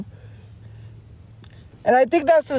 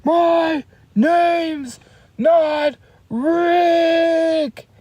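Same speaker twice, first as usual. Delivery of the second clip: higher, very drawn-out crying wail; Patrick's distressed cry; 7 semitones higher, much slower than usual. Retold or imitated. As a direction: stretch it into a whiny cartoon cry. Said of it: "when he's like".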